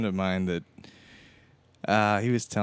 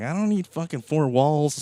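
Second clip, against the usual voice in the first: higher, louder carefree boast; great mood; 8 semitones higher, 6 dB louder than usual. Retold or imitated. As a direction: proudly declare it, drawn out and raised. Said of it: "in a great mood".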